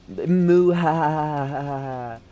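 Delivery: slow, drawn-out, loud "moo ha ha"; amateur evil laugh. Retold or imitated. Trainee trying out a villain noise, clumsy mock evil laugh. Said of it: "it's my evil laugh".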